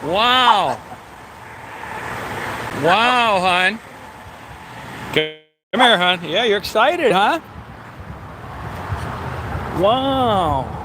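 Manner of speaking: high, drawn-out cooing at the dog. Real, not acted. Owner cooing at excited Daisy at the lookout in high baby talk.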